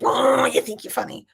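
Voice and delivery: mock irritated father calling out the cheek; dad's annoyance. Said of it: "he's like".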